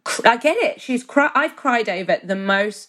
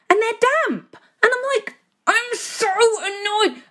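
high, put-upon whine about damp washing; exasperated moan; 12 semitones higher than usual. Retold or imitated. Wife moaning to partner about damp laundry, exasperated. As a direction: sound fed up and hard done by.

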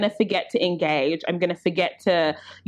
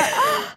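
shocked gasp. Real, not acted. Reaction to shocking story.

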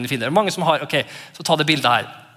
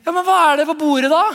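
put-on high, loud, quick kid question; childish asking; 9 semitones higher, 5 dB louder, faster than usual. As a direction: rush it out like an impatient child. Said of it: "så spør vi".

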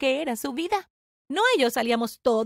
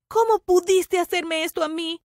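slow, raised, higher accusation; hurt reproach; 4 semitones higher, 4 dB louder than usual. Acted. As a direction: demand an answer, wounded. Reproche dolido en la pelea. (reproche dolido, lento y alto)

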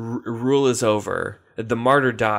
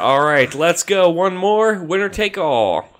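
game show hype; 6 semitones higher, 5 dB louder than usual. Real, not acted. Hyping up final round.

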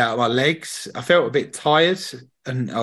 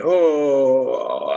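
mock moan. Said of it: "like, making moans".

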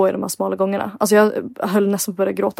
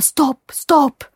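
higher, urgent mock shout; guide's raised warning voice; imitated warning call; 5 semitones higher than usual. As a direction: call out to halt someone ahead.

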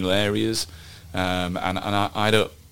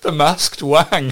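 laughing; 6 semitones higher, 7 dB louder, much slower than usual. Real, not acted. Laughing joke.

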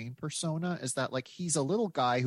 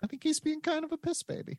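much higher, mock whiny voice; mock whiny repeat. Repeating his joke to the hosts, high mock whine. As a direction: squeak the insult like a pouting child.

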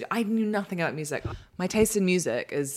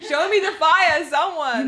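higher, louder, laughing; loud rallying call; 11 semitones higher, 10 dB louder, slower than usual. Shown as a demonstration. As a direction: call it out to the crowd. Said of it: "Say it with your chest".

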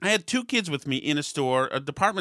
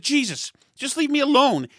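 higher, exasperated snap; irritated retort; 9 semitones higher, 3 dB louder than usual. Retold or imitated. Father telling the stranger to leave him alone, exasperated. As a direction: brush her off, fed up. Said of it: "I said".

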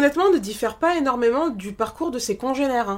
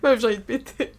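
laughing. Real, not acted. Laughing to tears.

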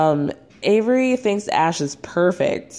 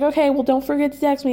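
mock Kara; 8 semitones higher, faster than usual. Mimicking Kara's exasperated reply.

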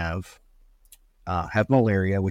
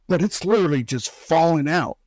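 a son's voice jumping higher in alarm at his hair coming out; 7 semitones higher than usual. Retold or imitated. Son telling his dad his hair is falling out, higher and alarmed. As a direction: let it pop out higher, worried and disbelieving.

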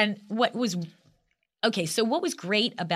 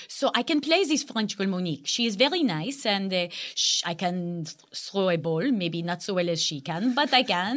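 put-on playful French girl accent; lightly put-on French accent, cute and sweet; a hint of a French girl voice. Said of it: "And so then I talk like this".

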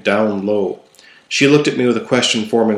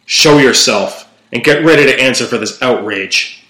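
commanding challenge; 4 dB louder than usual. Acted. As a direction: call out forcefully across a chamber to a hidden enemy. Challenges hidden creature, words echo.

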